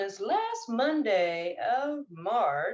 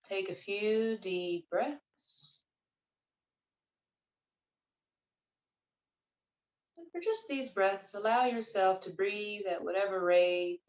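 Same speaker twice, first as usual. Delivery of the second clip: guided meditation. Real, not acted. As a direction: ease them gently into their breath, unhurried.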